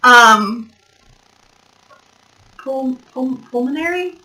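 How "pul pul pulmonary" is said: quiet, unsure word search. Real, not acted. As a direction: fumble for the word softly.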